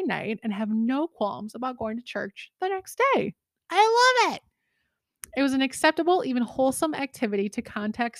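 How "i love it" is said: high, loud squeal of delight. Real, not acted. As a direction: gush it in a high voice. Delighted reaction to the story.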